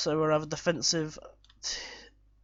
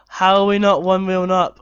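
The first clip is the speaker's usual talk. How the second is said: loud exasperated yell; frustrated shout; 3 semitones higher, 12 dB louder than usual. Real, not acted. Manager ranting at his team at half time. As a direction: demand an answer from the team.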